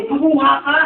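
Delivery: ordinary talk, nothing marked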